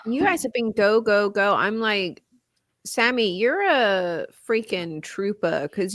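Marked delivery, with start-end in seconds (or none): none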